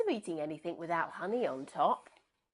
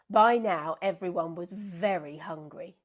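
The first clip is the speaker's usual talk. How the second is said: ordinary delivery, nothing marked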